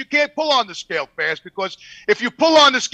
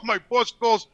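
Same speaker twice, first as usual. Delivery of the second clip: ordinary talk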